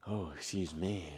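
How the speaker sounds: very soft, low voicing of a woman; soft coy quote. Stepmom to the man rubbing against her, soft and coy. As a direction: drop it low and hushed, imitating her.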